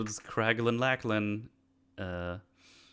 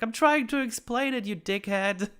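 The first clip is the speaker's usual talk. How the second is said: much higher, squeaky mock retort; 13 semitones higher than usual. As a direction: squeak out the insult comically. Mantis retort, retold comically.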